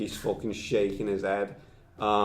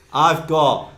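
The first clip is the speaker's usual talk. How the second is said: mock angry outburst; 6 semitones higher, 12 dB louder than usual. Acted angry boss laying down law.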